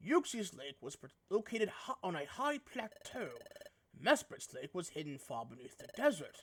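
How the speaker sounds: a hint of a low, soft, measured ghost; lightly voiced Dusknoir. Dusknoir explains the lakes to the team, lightly voiced and soft. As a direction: explain the clue quietly, lightly in character. Reads Dusknoir's game dialogue.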